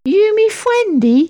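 weak, high-pitched creature voice; shy little sprite, unsure of a welcome; timid little voice. A narrator's reading. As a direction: ask timidly in a tiny high baby-talk voice. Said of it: "a rather weak little voice said timidly".

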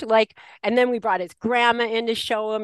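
normal talking voice

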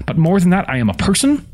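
high, urgent, building indignation; impassioned high-pitched protest, gathering force; defiant tirade. A narrator's reading. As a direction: work yourself up toward a yell. Eleanor working herself up to yell.

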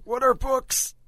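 put-on old woman voice. Joining the old-people bit.

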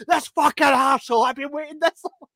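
mock fury with laughter breaking in; angry customer. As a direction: rant like the fed-up diner. Retold angry customer ranting.

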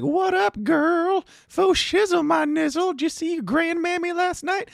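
very high-pitched mock old lady. Old lady greeting her girl as she walks in, high mock old-lady voice.

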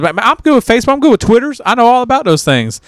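high-pitched hillbilly boasting; mock hillbilly insisting he qualifies. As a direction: imitate an over-confident country guy, pitch jumping well up. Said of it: "everybody's country and hillbilly as hell".

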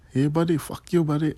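high-pitched attempt at an Indian accent. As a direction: cuss them out in a put-on Indian accent. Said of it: "I can't do an Indian accent".

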